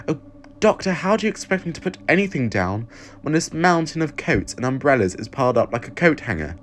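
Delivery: a flustered companion scolding the Doctor fondly; Evelyn's voice. Acted.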